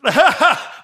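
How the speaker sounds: a sudden high, loud laugh; burst of real laughter. Real, not acted. Co-host teases about big lungs, bright real laugh. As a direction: crack up at the joke.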